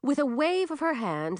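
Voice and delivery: plain reading voice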